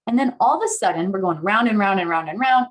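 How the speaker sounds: ordinary talk, nothing marked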